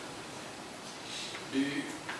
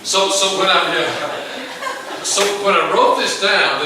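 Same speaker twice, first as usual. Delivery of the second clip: loud laughing disbelief; 8 semitones higher, 6 dB louder, slower than usual. Real, not acted. Preacher laughs at the congregation's raised hands, louder. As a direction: laugh through the words, louder. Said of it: "raising your hand".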